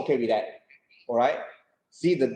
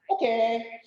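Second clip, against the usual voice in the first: higher-pitched, easygoing acceptance; welcoming change; 7 semitones higher than usual. Acted. Third-third worker told to change now, readily accepting. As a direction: take the change readily, no fuss. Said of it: "they are welcome change".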